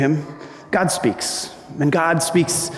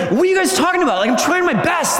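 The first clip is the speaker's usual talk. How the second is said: explode in anger; 11 semitones higher, faster than usual. Pretend. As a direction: blow up at them like you're fed up. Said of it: "explode in anger".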